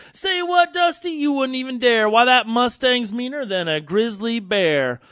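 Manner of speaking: high, startled cowpoke cry; surprised cowpoke. Acted. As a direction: blurt it out in disbelief. Cowpoke voiced in poem.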